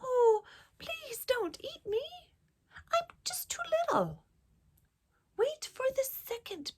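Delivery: high, tiny pleading goat; very small voice. A narrator's reading. Littlest goat begging the troll, high small voice. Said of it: "in his very small voice".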